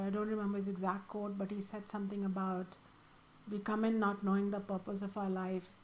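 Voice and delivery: usual speaking voice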